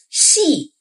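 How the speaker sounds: 戏 starting high, dropping sharply; falling tone xi. Shown as a demonstration. Teacher demonstrating 戏 for learners, sharp fall. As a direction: snap 戏 down. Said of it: "sharp falling tone".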